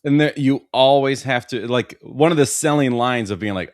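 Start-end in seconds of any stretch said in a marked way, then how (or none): none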